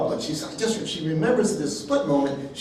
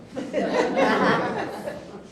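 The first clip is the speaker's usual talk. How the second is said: laugh. Real, not acted. Laughing at the coincidence.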